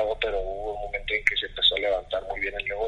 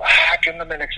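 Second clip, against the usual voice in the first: higher, loud burst of recognition; retold surprised greeting; 5 semitones higher, 8 dB louder than usual. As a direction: greet an old teammate out of the blue. Retold surprised phone greeting to old teammate.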